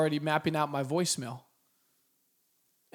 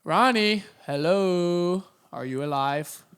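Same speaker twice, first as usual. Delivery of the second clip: having fun; 5 dB louder, much slower than usual. Acted. Recruiter leaving a voicemail for an ignoring prospect, playfully calling out. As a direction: tease someone who never picks up. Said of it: "And then I just have fun with it".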